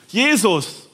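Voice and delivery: loud pleading call from afar. Acted. Lepers calling to Jesus from afar, loud and pleading. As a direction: call his name across the distance. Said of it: "riefen sie".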